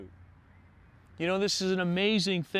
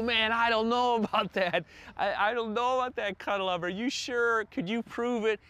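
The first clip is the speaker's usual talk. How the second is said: higher, quick, half-laughing voice of a doubter; doubtful skeptic; 5 semitones higher than usual. Retold or imitated. Pastor voicing a listener who doubts God's love, higher and quick. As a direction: play the unconvinced listener, lightly mocking. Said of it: "And you go".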